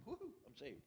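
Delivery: a high, soft little woo-hoo; mock cheer. Newly saved man mocking his own cheer, high and soft. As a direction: toss off a thin mock cheer.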